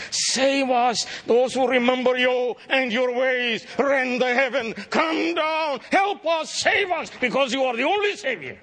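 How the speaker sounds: high, drawn-out crying-out to God; impassioned pleading prayer. Acted. Preacher crying out Isaiah's prayer to the congregation, high impassioned plea. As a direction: plead for rescue at the top of the voice.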